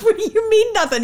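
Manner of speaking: high-pitched, amused imitation of baffled Dad; incredulous dad. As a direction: question it in high, laughing disbelief. Voices Dad's disbelieving reply.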